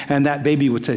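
usual speaking voice

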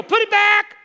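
loud, high command; commanding shout. Retold or imitated. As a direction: order it back with full force. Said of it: "Some of you need to go".

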